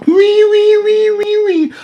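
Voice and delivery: very high, wailing mock sobs; mock baby crying. Mocking Nick Fuentes as a crybaby, high mock wail. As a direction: wail like a bawling infant to mock him. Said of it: "I need to hear him crying like a baby".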